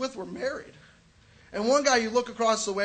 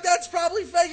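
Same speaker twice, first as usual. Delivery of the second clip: loud, high comeback; shouted retort; 9 semitones higher, 6 dB louder than usual. Retold or imitated. Comic shouting back at the friend, loud and high. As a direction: yell it back at him. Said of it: "I'm like".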